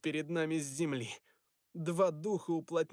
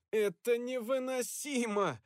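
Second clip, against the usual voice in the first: high whiny complaint; complaining; 7 semitones higher, slower than usual. Acted. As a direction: whine it out, exasperated. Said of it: "пожаловался я".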